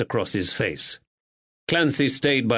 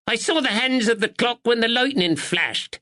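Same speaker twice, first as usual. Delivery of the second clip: put-on sharp old woman; 12 semitones higher than usual. A narrator's reading. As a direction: snap it out like a sharp sparrow.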